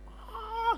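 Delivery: brief high-pitched imitated scream; short squeal of mock fright. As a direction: yelp like a rider on a pretend slide. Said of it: "itu orang bisa teriak-teriak".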